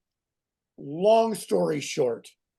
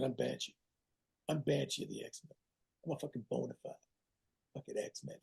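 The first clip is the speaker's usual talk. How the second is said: a near-voiceless, much softer confession; hushed boast; 4 semitones lower, 16 dB quieter than usual. Retold or imitated. The actor quietly tells a gym buddy he is Banshee. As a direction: lean in and reveal it like a secret.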